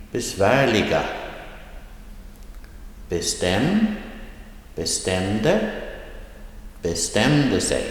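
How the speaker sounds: careful word drill, bestämde rising from -stäm-; rising tone, second syllable. Shown as a demonstration. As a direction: lift the second syllable each time, beSLUT, beKANT, beSTÄM. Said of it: "rising tone position is in the second syllable".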